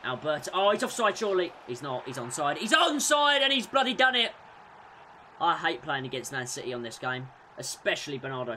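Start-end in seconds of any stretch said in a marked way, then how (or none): 2.6-4.3 louder, higher disbelieving cry; exasperated outburst. Real, not acted. Gamer reacting as the opponent scores, exasperated. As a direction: blurt it out in angry disbelief.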